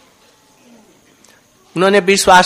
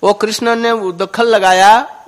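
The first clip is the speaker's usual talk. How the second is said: ordinary talk, nothing marked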